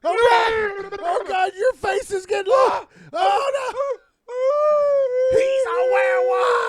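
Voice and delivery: high terrified shriek; terrified screaming. Acted. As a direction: scream in horror. Screaming witness to werewolf.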